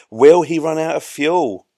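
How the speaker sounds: mock jeopardy. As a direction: ham up the cliffhanger for the audience. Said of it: "bit of jeopardy".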